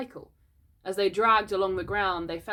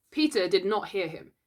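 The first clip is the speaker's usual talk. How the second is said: ordinary delivery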